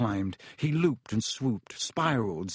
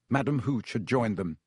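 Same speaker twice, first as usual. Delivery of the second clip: ordinary delivery, nothing marked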